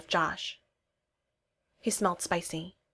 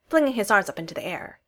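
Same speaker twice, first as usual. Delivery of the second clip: plain reading voice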